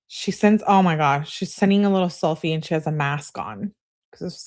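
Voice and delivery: ordinary talk, nothing marked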